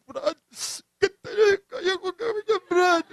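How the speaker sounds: high, teary mock sobbing plea; imitating crying plea. Man retelling how he cried begging his friend for help, teary imitation. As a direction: replay the tearful begging to your friend. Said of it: "Paiyak-iyak pa po ako".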